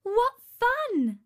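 high, loud burst of glee; delighted exclamation. A narrator's reading. Princess playing with the spinning wheel, delighted exclamation. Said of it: "exclaimed Talia".

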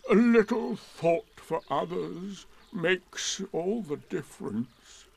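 slow, high, drawn-out Eeyore voice; gloomy donkey. Acted. Weary donkey lecturing all the animals, slow and mournful. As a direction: droop every word like a weary old donkey.

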